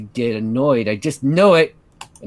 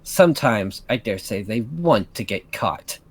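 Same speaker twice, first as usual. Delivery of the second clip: low, sour resentment; bitter; 4 semitones lower than usual. Acted. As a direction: grumble it with bitter scorn. Catherine bitter about the lovers.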